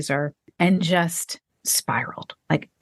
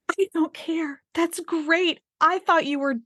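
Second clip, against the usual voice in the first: high, breathless burst of relief to her son; overjoyed relief, pitched way up; relieved outburst; 11 semitones higher than usual. Retold or imitated. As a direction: blurt it out, giddy with relief that he's alive. Relieved mother answers son's call.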